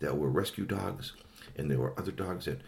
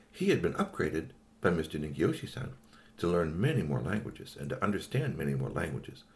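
plain reading voice

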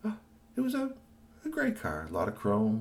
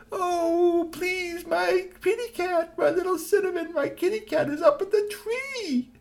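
very high, slow, teary plea; pleading crying woman; 5 dB louder, slower than usual. Acted. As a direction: beg through tears for the kitty. Young woman pleads, cat in tree.

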